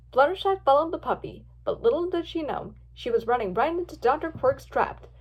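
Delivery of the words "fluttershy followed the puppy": plain reading voice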